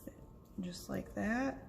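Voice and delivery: soft unvoiced whisper to herself. Real, not acted. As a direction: whisper it while working. Crafting, muttering to herself.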